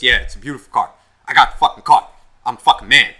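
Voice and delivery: loud, boastful impersonation; cocky bragging. Retold or imitated. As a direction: crow like a kid with a new car. Voicing the kid bragging about car.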